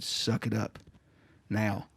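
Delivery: low, soft, measured command; restrained, no-nonsense order; stern retold order. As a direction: quote the medic quietly, pausing before the last word.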